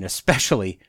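ordinary delivery

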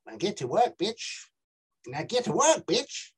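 Aussie accent, pitch flicking up at the ends; broad Australian upswing on each phrase. Shown as a demonstration.

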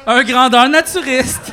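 loud, slow, laughing repeat of the pun; laughing shout. Real, not acted. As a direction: bellow it out through laughter. Echoing a pun, laughing loudly.

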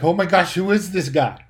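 loud, high retold astonishment; shocked reaction. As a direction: blurt it out in acted disbelief. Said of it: "it so shocked me".

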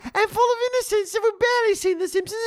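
very high squeaky put-on voice; hammed-up squeaky youngster, innocently reminiscing; mock younger self. Parody of their younger, higher-voiced selves.

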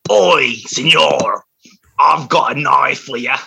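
put-on slow, broad British-or-Aussie accent; put-on British tough guy; mock British accent. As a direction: drawl it in a hammed-up British accent. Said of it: "Crocodile Dundee".

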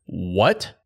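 reliving the shock of hearing it; stunned, loud; retold disbelief.

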